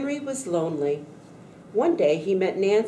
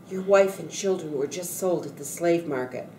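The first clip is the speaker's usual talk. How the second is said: plain reading voice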